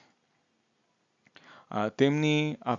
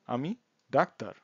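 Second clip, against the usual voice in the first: ordinary talk, nothing marked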